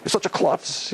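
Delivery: put-on teasing teenager voice; a teen mocking a close friend; mock teen insults. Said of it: "they say".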